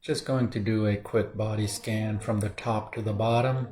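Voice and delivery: soft, calm meditation guide voice; soft guiding voice settling into the body scan; guided meditation. Acted. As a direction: ease them into stillness quietly. Leads a meditation.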